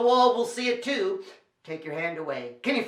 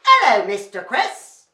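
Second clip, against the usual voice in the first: loud put-on British greeting; British accent; 7 dB louder than usual. Shown as a demonstration. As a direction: demonstrate a British-accented hello for the children. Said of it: "say it with a British accent".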